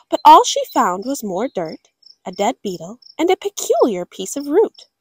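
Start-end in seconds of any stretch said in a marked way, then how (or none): none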